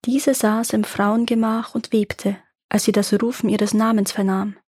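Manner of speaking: ordinary delivery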